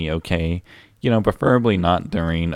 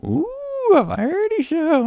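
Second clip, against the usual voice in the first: mock high voice; 4 dB louder, slower than usual. Mocking the word variety.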